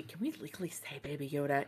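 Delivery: a conspiratorial whisper; hushed legal worry; whispered aside. Real, not acted. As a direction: whisper it like a secret. Aside about copyright worries.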